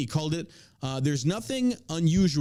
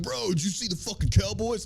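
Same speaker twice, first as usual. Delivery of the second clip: sports bro voice; 6 semitones higher than usual. Retold or imitated. Mocking sports bros.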